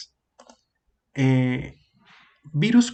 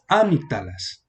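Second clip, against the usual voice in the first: "amígdalas" stressed on the opening A, Á-mig-da-las; wrong stress on first syllable. Shown as a demonstration. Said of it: "aquí el acento aquí sería". («amígdalas» mal acentuado al inicio: Ámigdalas)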